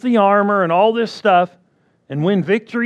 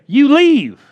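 commanding rebuke; 2 semitones higher, 4 dB louder, slower than usual. Retold or imitated. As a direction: order it out.

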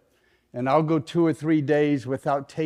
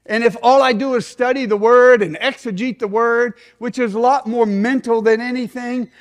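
higher-pitched, emphatic sermon delivery; impassioned preaching; 7 semitones higher, 5 dB louder than usual. Real, not acted. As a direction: preach it hard, driving each point home. Preaches at full force.

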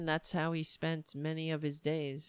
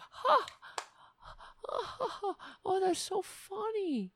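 high-pitched mock amusement; sarcastic fake laugh; 12 semitones higher than usual. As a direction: fake a laugh at a lame joke. Mocking the senator's unfunny joke.

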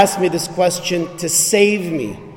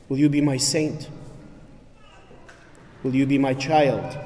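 softer, unhurried questions with long pauses; tender proposal; 2 semitones lower, 5 dB quieter than usual. Acted. Jesus asking the listener to be his bride, softly. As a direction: ask gently, as a lover proposing.

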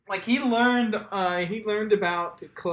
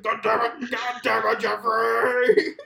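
gruff mock dad; 6 semitones higher, 4 dB louder, slower than usual. Quoted dad inside caller bit.

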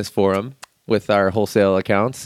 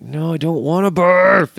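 drawn-out, higher-pitched whine of protest; mock freak-out; 8 semitones higher, 4 dB louder, slower than usual. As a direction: play up a childish panic, stretching the words. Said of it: "we freak out a little".

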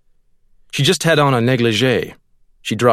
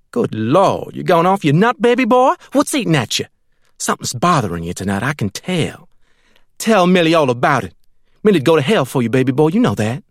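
higher, fussing, clingy woman; doting Millie; 6 semitones higher than usual. A narrator's reading. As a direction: fuss over him like a smothering lover. Millie fusses over narrator.

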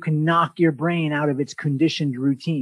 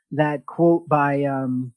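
ordinary talk, nothing marked

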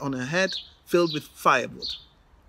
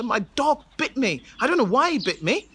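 higher, different whiny voice; flustered hunter; 6 semitones higher, 3 dB louder than usual. Acted. The hunter explaining his dog bite to the king, higher whiny. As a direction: whine out an excuse.

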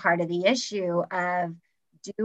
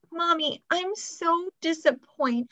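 put-on much higher, slow, whiny little girl; 8 semitones higher than usual. Mom imitating her little daughter scolding her, higher whiny child voice. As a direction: pout it out like a small child. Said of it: "she'll say".

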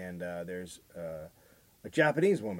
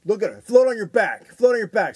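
higher, louder barked orders; mock commanding dad; 9 semitones higher, 9 dB louder than usual. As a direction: order the girl to float. Dad ordering daughter in pool.

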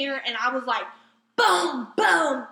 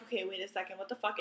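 quiet disbelief; 7 semitones lower, 11 dB quieter, faster than usual. Retold or imitated. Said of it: "I'm like".

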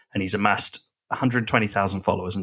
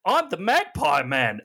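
Magpie Man impression; 9 semitones higher than usual. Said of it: "being like".

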